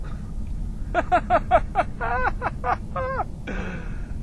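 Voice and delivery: long, high, gleeful cackle; helpless laughing from the thrill; giddy laughter. Real, not acted. Thrill of accelerating uphill.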